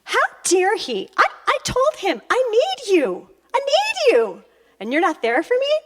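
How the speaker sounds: high-pitched mock indignation; hammed-up wounded outrage; really annoyed. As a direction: plead and scold in a high, rising whine. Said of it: "then I get really annoyed".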